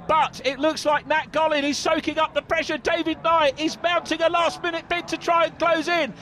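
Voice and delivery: rising to a high excited call; excited commentator. Real, not acted. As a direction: call the finish with mounting excitement. Race finish commentary build-up.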